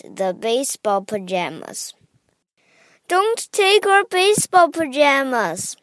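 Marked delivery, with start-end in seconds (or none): 3.1-5.8 high-pitched storybook wail; crying out in protest. A narrator's reading. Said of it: "cried Tommy".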